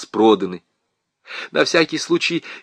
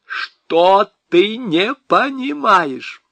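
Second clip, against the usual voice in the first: much higher, very slow, spelled out; syllable by syllable; 8 semitones higher, much slower than usual. A narrator's reading. Husband spells out his question to wife, slowly. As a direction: pick apart each syllable slowly. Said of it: "спросил по складам".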